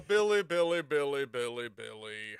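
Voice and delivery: fading disappointment. Real, not acted. Streamer losing interest in the video, trailing off. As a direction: let each Billy sink away.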